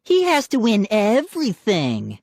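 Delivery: high, mocking jibe; sneering. A narrator's reading. Sister complaining to mom about brother, sneering.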